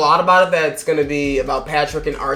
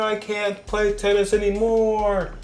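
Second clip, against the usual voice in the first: high, wailing mock despair; hammed-up injured tennis star; 6 semitones higher, slower than usual. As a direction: whimper like the injured player. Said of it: "She's like".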